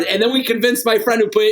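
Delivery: normal talking voice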